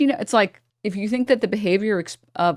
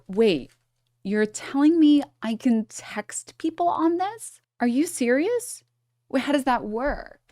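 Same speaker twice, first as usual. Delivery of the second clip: higher, slow airhead voice, faking amazement; playing dumb; 6 semitones higher than usual. Woman playing dumb to a guy explaining phones, high mock-airhead voice. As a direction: play the clueless girl pretending she's never seen a phone. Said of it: "you'd be like".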